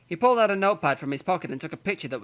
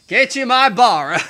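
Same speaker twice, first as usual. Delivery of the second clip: put-on slow Yorkshire accent; hammy Yorkshire man voice; northern accent; 7 semitones higher, 9 dB louder than usual. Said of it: "defaulted to my northern accent".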